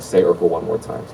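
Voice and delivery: usual speaking voice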